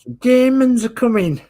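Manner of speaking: very high, loud mock Scouse; awful Scouser accent. Host jokes about wartime Liverpool in a high mock Scouse accent. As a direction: cry a mock alarm in bad Scouse. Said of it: "that's my awful, um, Scouser accent".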